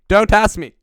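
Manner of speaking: loud, high-pitched playful yell; hammed-up shouted refusal; mock shout. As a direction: blast it out high and silly. Playful shouting back and forth.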